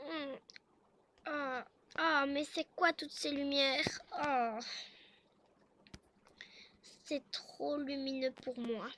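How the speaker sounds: a touch groggy, words dragged out; slow grumbling at being woken; groggy waking husband. Acted. Jaden wakes, bothered by lights. (mari mal réveillé, voix traînante)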